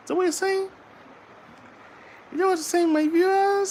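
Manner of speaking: very high cooing voice. Real, not acted. Father cooing at his baby.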